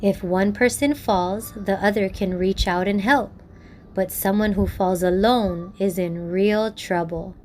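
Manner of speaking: gentle, measured storyteller close; story narration. A narrator's reading.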